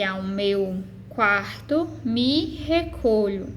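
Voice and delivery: slow syllable-by-syllable verse reading; escansão silábica. Shown as a demonstration. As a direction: measure out each syllable of the line slowly. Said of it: "como eles são pronunciados e o tempo de pronúncia". (leitura lenta, sílaba por sílaba)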